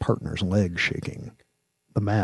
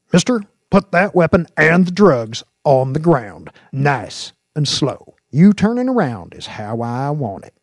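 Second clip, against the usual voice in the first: loud, raised voice of command; confident; 7 semitones higher, 7 dB louder than usual. A narrator's reading. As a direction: give firm orders like a lawman in charge. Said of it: "confidently though".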